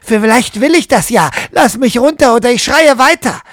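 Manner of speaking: high, loud, furious threat; angry defiance. Acted. Trapped woman threatens narrator, loud angry defiance. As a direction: defy him and threaten to keep screaming. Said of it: "blicken mich wütend an".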